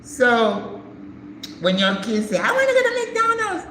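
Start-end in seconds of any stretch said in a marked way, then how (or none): 2.4-3.6 put-on high, fast little-kid voice; whiny kid. Mother mimicking her kids begging to go to McDonald's, high whiny kid voice. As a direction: beg like a child wanting McDonald's.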